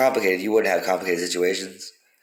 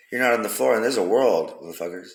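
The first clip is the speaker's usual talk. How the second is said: ordinary talk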